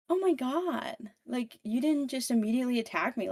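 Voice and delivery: high, fast astonished gush; amazed creature. Retold or imitated. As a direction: marvel that she didn't attack. Creature surprised by friendly Evie.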